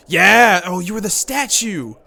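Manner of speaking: loud, high, delighted cry; excited discovery. Real, not acted. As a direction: shout with joy at the reveal. Realises the statue is Kuisai.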